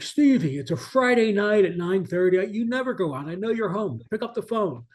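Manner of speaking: teasing nudge to answer the phone; imitating John's phone message. He's like.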